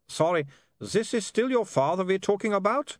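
put-on high, puzzled vampire with Germanic accent; vampire with comic accent.

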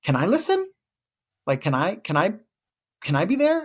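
high, pleading, halting questions; little child's voice. Retold or imitated. Little one asking to hear the letter, high and hopeful. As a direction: ask eagerly like a small kid. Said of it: "the little one was like".

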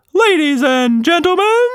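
high, loud ringmaster call; showman bellow. A narrator's reading. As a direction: announce it like a circus showman. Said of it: "Quatch Monster bellowed".

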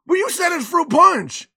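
high, loud objection; mock protesting kid. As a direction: imitate a child pleading their case indignantly. Child protests to mom.

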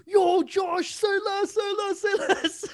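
loud excited yelling; party shout. Retold or imitated. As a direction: hype shout with laughter. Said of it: "They're like".

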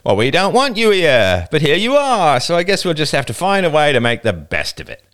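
put-on older boy lecturing the intruding girl, scornful. A narrator's reading. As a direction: talk down to her like an unwelcome pest. Older boy speaks to girls.

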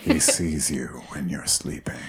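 slow, low, menacing lyric; creepy tagline. Acted. Co-host turning a Christmas song into a horror tagline, creepy and slow. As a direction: intone it like a horror trailer.

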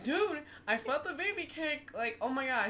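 high, breathless excitement; excited. Retold or imitated. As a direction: gush about the first kick. Retelling excitement at first kick.

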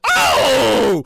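loud, drawn-out catchphrase yell; slow booming "they got the right one"; hype shout. Acted. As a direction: belt the catchphrase.

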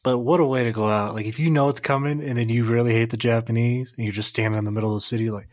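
normal talking voice

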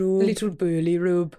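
slow mock British cat-talk; imitating Khan's accent. Podcaster repeating boyfriend's cat-talk, slow imitated accent. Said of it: "and he goes".

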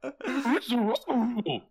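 high, slow hammed-up cartoon reveal; mock monster reveal. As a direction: unmask the monster, cartoon style. Scooby-Doo monster reveal.

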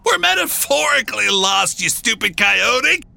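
high-pitched, irritated shout; exasperated shout. A narrator's reading. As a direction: shout it high, fed up with the fool. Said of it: "one of them shouted".

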